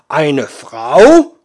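loud, appalled disbelief. Acted. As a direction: recoil at the idea. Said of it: "Der Riese war entsetzt".

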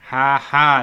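a dry, joyless ha-ha, loud; humorless laugh. A narrator's reading. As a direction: laugh without any fun in it. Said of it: "lachte der Zwerg humorlos".

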